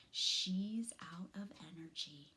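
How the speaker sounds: ordinary delivery, nothing marked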